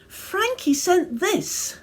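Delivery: put-on high, slow little-boy voice; child wise man. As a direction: pipe it up slowly like a small boy. Child wise man's line.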